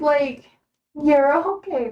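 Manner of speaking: normal talking voice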